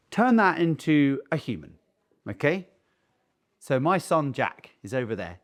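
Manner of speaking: ordinary talk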